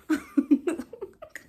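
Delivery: loud high cackle; high laugh. Real, not acted. After bragging to viewers, a loud high delighted laugh.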